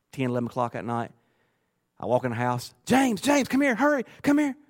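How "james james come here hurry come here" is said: high-pitched, excited imitation of a wife calling; retold call, pitched way up; urgent excited calling. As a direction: mimic her summoning her husband in a hurry. Wife urgently calls husband home.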